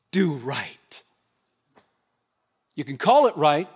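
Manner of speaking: usual speaking voice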